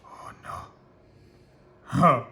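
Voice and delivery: usual speaking voice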